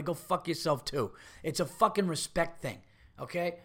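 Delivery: ordinary delivery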